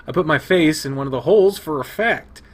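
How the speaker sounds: ordinary talk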